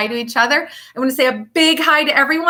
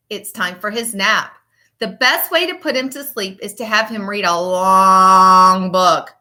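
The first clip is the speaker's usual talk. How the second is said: lively, sing-song picture book reading; reading to children. Acted. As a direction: read it like storytime for little kids. Teacher reads picture book aloud.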